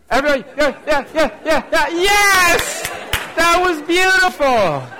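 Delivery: high, loud crowd-leading shouts; exuberant cheering. Real, not acted. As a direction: whoop the crowd through a stadium wave. Leading congregation in the wave.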